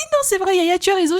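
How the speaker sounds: high, amused agreement; mother-in-law conceding to her daughter-in-law, high; mother-in-law agreeing. Retold or imitated. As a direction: give in with a laugh. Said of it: "Elle m'a dit".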